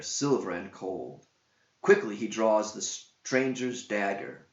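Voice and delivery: ordinary delivery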